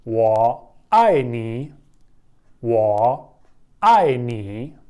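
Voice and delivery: वो आई नी spelled out very slowly with Mandarin tones; slow Chinese demo. Shown as a demonstration. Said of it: "तो हम कैसे कहेंगे?". (वो आई नी को बहुत धीरे, टोन के साथ बोलना)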